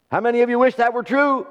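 rally the congregation, pitched well up; fervent, ringing sermon delivery; impassioned preaching. Real, not acted. Preaching at raised intensity.

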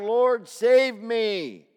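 loud, slow desperate cry; slow pleading shout; cried out. Acted. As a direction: shout it out like a drowning man. Preacher reading Peter's cry.

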